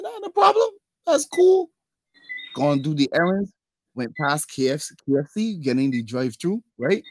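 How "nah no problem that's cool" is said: mock breezy. Retold breezy agreement to girlfriend.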